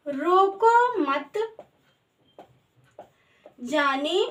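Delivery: slow, word by word with long gaps; spaced-out, drawn-out dictation. Shown as a demonstration. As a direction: dictate it slowly as if writing it out. Writing the sentence out on board.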